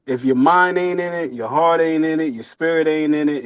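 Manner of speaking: ordinary talk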